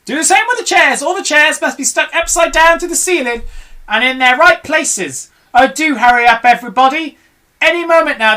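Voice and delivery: high loud hurried commands; urgent orders. Acted. Mugglewump hurries the animals, high and urgent. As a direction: hurry them before the twits come.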